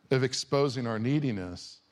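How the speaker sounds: usual speaking voice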